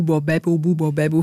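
Bo Bebo Bu with lips pushed round and wide; articulation exercise. Shown as a demonstration. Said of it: "Kennen Sie das?".

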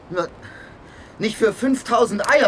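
high, loud agitated cry; worked-up outburst. Acted. Young man refuses, agitated, loud and high. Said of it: "Bleib ruhig, Junge. Nicht immer gleich so aufregen".

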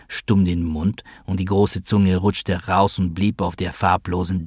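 plain reading voice

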